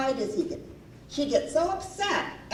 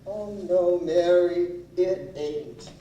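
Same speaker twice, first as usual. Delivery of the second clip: low-pitched husband voice, sinking at the end; imitated pleading husband; 4 semitones lower, slower than usual. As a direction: answer as the husband, deep and heavy. Voices husband answering plea.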